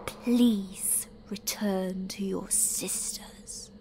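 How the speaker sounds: a cult leader's icy whispered order; cold whisper. Acted. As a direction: quietly dismiss her, holding the anger in. Leader dismisses sister, faces Doctor.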